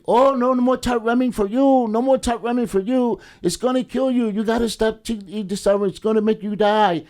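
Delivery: high-pitched nurse warning against top ramen; imitating the nurse lady. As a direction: put on the pill lady scolding an inmate. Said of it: "She's telling the guy".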